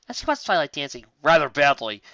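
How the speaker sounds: usual speaking voice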